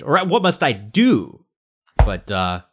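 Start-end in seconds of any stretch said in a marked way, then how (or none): 0.0-1.5 slow, higher, drawn-out "what must I do"; hammed-up stress on DO. Shown as a demonstration. Host hamming up the title for laughs, slow and high. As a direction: stretch it out like a mock plea, "do" held. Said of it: "emphasize a different word every time".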